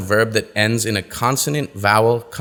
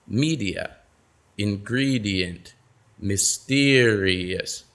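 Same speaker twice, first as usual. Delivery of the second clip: Media, ingredient, mysterious said slowly, stressed syllable before the suffix held long; much slower than usual. Shown as a demonstration. Teacher modelling suffix stress, slow and deliberate. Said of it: "notice how the stress falls".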